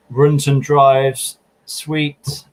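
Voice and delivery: a hint of car-ad patter; typical car seller. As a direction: lightly imitate an owner's sales line. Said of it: "what's another thing they say".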